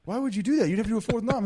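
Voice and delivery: high, whiny imitation of the question; mock outraged whine. Friend re-enacting Vince's outburst, high mock-outraged whine. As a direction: whine it up high like an upset player. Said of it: "He's like".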